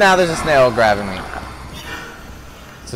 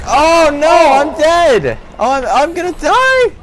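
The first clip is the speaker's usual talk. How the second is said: high, loud game panic; panicked; 11 semitones higher, 6 dB louder than usual. Real, not acted. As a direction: cry out as doom closes in. About to die in game.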